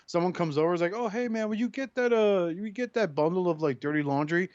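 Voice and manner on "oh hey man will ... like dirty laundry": 1 casual guest. Pretend. Guest voiced asking.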